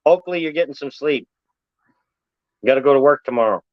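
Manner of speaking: quick joking aside; fast teasing. Real, not acted. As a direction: rattle it off fast. Teasing a viewer.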